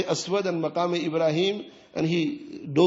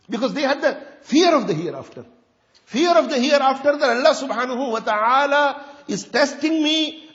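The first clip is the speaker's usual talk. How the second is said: louder, higher, pleading preacher's voice; impassioned preaching; 8 semitones higher, 6 dB louder than usual. Acted. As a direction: preach it, rising with dread of the hereafter. Preacher voices fearful inner questions.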